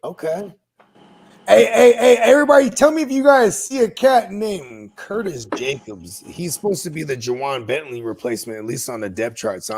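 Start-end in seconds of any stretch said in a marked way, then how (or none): 1.5-4.3 loud, higher-pitched call to the group; calling for attention. Real, not acted. Host asks everybody about a player, loud attention-getting call. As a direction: cut in loudly to get everyone listening.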